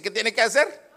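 normal talking voice

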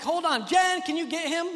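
acted-out flustered dad; calling for help. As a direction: call urgently into the other room. Dad calls wife for help.

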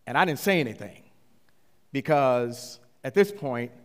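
ordinary talk, nothing marked

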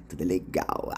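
hushed ironic repeat; soft echo. Shown as a demonstration. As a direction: echo "Tudo é legal" under your breath, as an ironic aside.

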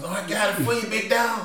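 eager to please a buyer; crackhead voice. Retold or imitated. Imitating a crackhead negotiating.

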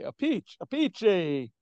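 "a peach, a peachy" punched high and loud; Italian pronunciation. Shown as a demonstration. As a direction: ring out "a peachy" Italian-style, stress on PEACH. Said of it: "That's the Italian way".

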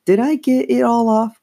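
put-on high-pitched girl's question; worried question. A narrator's reading. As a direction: ask anxiously in a high voice. Girl asks sister about gum.

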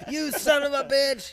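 high, slow hammed-up cuss; playful mock curse at the cow. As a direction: drag out a mock insult, voice up high.

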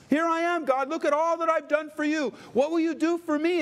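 high, whiny self-important plea; mock proud believer. Believer bragging of his works to God, high and whiny.

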